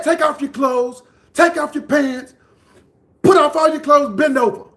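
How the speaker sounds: high, loud imitation of a man giving orders; forceful mock yell; retold shouted order. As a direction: act out shouting commands at frightened kids.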